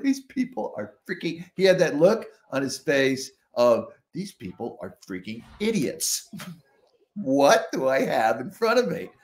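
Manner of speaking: a hint of a fed-up judge; judge's exasperation. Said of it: "He's like".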